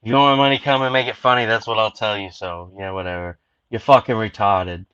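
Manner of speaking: lightly put-on Boston accent, loud at the start; a hint of a Boston guy hamming up a greeting. Host teasing Jack in a lightly put-on Boston accent. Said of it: "I used my best Boston accent for you".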